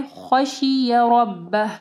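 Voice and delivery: slow खशिय रब्बह, thick kh and r; heavy ra, ending h. Shown as a demonstration. Teacher repeats for students, slow tajweed. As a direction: chant रब्बह, stopping on a breathed h. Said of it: "आखिर में ये हाँ पढ़ना है".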